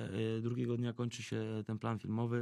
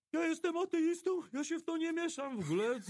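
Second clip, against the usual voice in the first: much higher, panicky voice of a fleeing thug; scared thug backing off; 14 semitones higher than usual. Retold or imitated. Fleeing thug to the rosary-holder, high panicky protest. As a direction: squeak it out like a tough guy losing his nerve.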